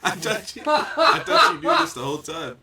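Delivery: high, loud laughing words; laughing disbelief. Real, not acted. Amazed friend didn't know about Butcher Box, laughing.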